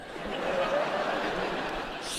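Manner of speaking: breathy wheezing laugh up high; high unvoiced laugh. Real, not acted. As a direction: let the laugh squeak out. Laughing at own hostage joke.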